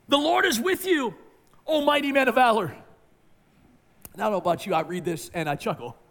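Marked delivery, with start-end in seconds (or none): 0.1-2.8 high, loud proclamation; ringing angel's greeting; proclaiming angel. Acted. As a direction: announce it like a herald angel.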